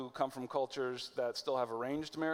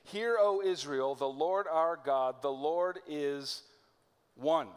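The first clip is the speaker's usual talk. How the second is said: solemn confession; slower than usual. Acted. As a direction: proclaim it slowly, like a creed. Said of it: "that great confession".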